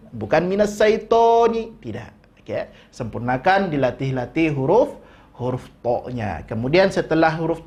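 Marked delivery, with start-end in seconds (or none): none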